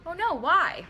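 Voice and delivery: high, loud mock alarm; concerned surprise. Replying to the stressed boss, high loud surprised concern. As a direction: gasp it out as if shocked to hear it.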